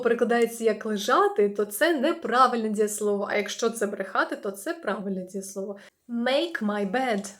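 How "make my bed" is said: slow model phrase. Shown as a demonstration. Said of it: "teacher models phrase".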